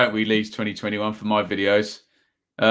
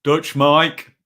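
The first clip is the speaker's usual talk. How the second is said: Brummie accent; 2 semitones higher than usual. Shown as a demonstration. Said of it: "I can't do the Brummy accent".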